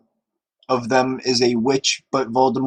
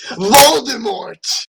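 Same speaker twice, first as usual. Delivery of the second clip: slow, high, loud announcement; hammed-up villain reveal; dramatic reveal; 9 semitones higher, 6 dB louder, much slower than usual. A narrator's reading. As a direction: drag out the name like a big reveal.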